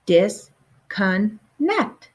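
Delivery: Disconnect very slowly as dis, con, nect; three separate beats in "Disconnect", paused between; syllable by syllable. Shown as a demonstration. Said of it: "one with three syllables".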